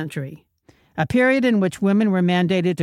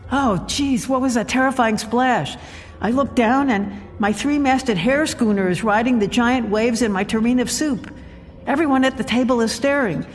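high-pitched, mounting alarm; past-life panic; 6 semitones higher than usual. Acted. Woman trapped in a dinner-party flashback, high panicked voice. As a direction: act out a nightmare flashback, voice pitched up. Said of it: "I'm having another past life flashback".

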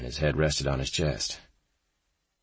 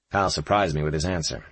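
ordinary delivery

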